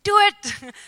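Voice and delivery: high, loud cheer of encouragement; a cheerleading squad egging you on; inner cheerleader. Retold or imitated. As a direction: cheer yourself on like a pep squad. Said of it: "a cheerleading squad inside of me".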